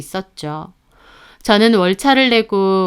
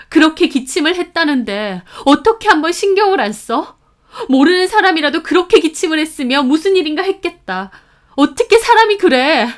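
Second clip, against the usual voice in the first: high, upset scolding, climbing to the end; anguished reproach; 8 semitones higher, 4 dB louder than usual. A narrator's reading. Sister blaming her brother for ignoring the cough, anguished reproach.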